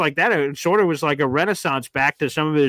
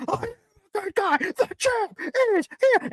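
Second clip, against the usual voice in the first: very high, slowed malfunctioning toy voice; broken wrestler action figure; waterlogged action figure. Acted. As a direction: squeak the catchphrases out like a soggy talking toy. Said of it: "his action figure got water in it".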